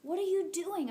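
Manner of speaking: high, startled cry; almost scream it in alarm; near scream. Acted. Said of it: "I almost scream".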